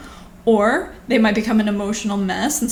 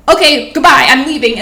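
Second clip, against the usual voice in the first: loud, higher, mock-abrupt goodbye; bluffing goodbye; 4 semitones higher, 12 dB louder than usual. Fed-up parent loudly bluffing to a toddler that they're leaving. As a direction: call it out like you're really walking away.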